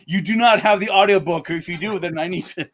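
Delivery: high, loud joking objection; playful indignant disbelief; mock alarm. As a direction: protest it loudly through a laugh. Mock objection to fan having bootleg.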